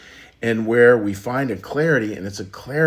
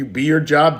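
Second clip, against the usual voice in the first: ordinary talk, nothing marked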